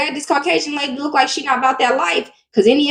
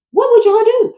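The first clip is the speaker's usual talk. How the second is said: pitched way up, what would you do; exasperated question; 9 semitones higher than usual. Real, not acted. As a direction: throw the question out to the crowd. Asking viewers, exasperated.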